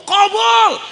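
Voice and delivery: high loud call; comic loud answer; punchline call. Real, not acted. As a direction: bang out the answer. Punchline granted.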